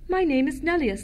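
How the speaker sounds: plain reading voice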